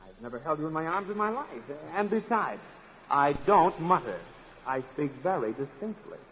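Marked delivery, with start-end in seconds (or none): none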